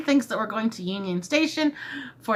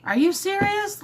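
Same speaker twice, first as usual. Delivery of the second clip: disbelief up high; stunned; 9 semitones higher than usual. Retold or imitated. Told she can have the spare ticket, high disbelief. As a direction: gasp it out in disbelief. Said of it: "And I'm like".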